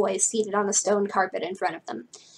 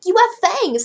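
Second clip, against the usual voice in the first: high, loud cry of amazement; excited shout; 11 semitones higher, 7 dB louder than usual. Acted. Frankie to Lala on seeing her fangs, shouting excitedly. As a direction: exclaim it over the music. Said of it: "Frankie shouted over the music".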